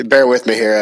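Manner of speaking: usual speaking voice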